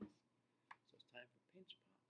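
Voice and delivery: soft secret whisper; hushed announcement. Real, not acted.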